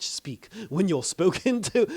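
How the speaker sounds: slow haughty rebuke. Retold or imitated. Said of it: "And she's like".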